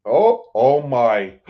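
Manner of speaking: slow, stunned "oh, oh my"; surprised. Real, not acted. Vlogger spotting a favourite channel in his live chat, stunned. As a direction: caught off guard by a surprise visitor. Chat guest suddenly appears.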